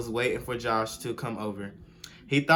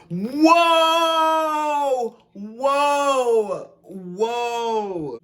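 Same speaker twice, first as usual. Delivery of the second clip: high loud whoa fading down; exclaim, then trail off; shocked whoa; 11 semitones higher, 6 dB louder, much slower than usual. Real, not acted. Reacting to the story, high shocked whoas.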